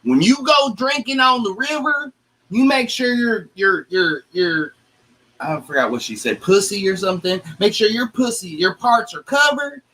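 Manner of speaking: lightly voiced preachy advice giver; mimicking the woman. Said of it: "So she was like".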